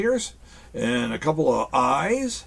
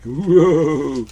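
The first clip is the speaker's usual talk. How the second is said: whoa; 7 dB louder than usual. Real, not acted. Amazed at the dragon's eyes.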